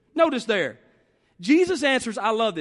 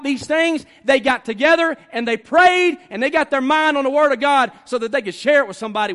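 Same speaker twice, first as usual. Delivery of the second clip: loud, emphatic sermon with stretched key words; forceful preaching; 4 semitones higher, 6 dB louder than usual. Real, not acted. Preacher telling how the early church prayed, forceful preaching. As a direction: drive each point home to the congregation.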